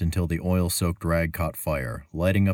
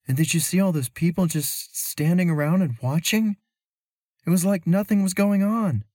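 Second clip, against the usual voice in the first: put-on high, lifted young woman's voice; Mira's voice; 13 semitones higher, 3 dB louder than usual. A narrator's reading. Traveler telling her companion she's puzzled, in a high worried voice. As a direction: voice Mira up high, puzzled and uneasy. Mira speaks.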